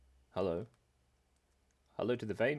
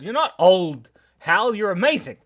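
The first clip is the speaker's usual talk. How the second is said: loud, bright, high reassurance; excited reassurance; 6 semitones higher, 11 dB louder than usual. Real, not acted. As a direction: cheer your friend up loudly. Reassuring a friend loudly.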